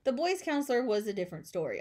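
ordinary delivery, nothing marked

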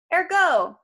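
high, sharp-rising magic word; loud command. Acted. Krusty ordering the ropes out, a loud high command. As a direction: call it out like a spell.

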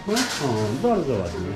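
ordinary delivery

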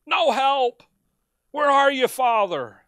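a loud cry to the Father; anguished call. Acted. Voicing Christ calling the Father.

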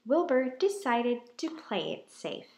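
ordinary delivery

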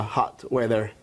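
ordinary talk, nothing marked

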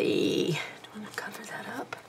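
soft unvoiced whisper to self. Real, not acted. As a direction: whisper it under your breath. Whispering to herself.

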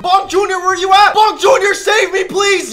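high, loud calls for help; panicked calling out. Real, not acted. Gamer yelling for his baby mob to save him. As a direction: shout across the cave for rescue.

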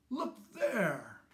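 high, loud call to the crowd; alarmed call. A narrator's reading. Farmer calling villagers to see the fire, alarmed shout. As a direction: point and cry out in alarm.

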